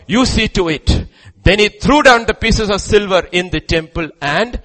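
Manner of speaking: loud, high scripture declamation; full-force preaching. Acted. Preacher proclaiming Judas's remorse from scripture, full-force preaching. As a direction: proclaim the verse to the back rows.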